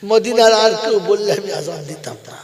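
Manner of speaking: loud, emotional retold declaration; impassioned repeat. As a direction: cry the refusal out loudly. Same words repeated as impassioned outcry.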